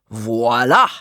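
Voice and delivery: mild theatrical reveal; flourish. A narrator's reading. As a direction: announce it like a magician. Dramatic curtain reveal.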